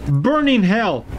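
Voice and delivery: furious yelling. Real, not acted. Gamer fighting the spider train, high angry yells. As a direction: spit curses at the monster.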